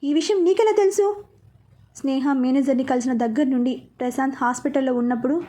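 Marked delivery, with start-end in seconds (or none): 0.0-1.2 much higher, stunned disbelief; shocked question. A narrator's reading. Charan hearing who killed the family, shocked and high. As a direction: caught completely off guard. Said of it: "Charan shock అయ్యి".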